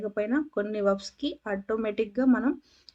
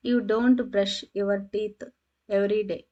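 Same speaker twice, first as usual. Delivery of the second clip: slow clear dictation; 4 dB louder, much slower than usual. Shown as a demonstration. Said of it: "example read slowly, loud".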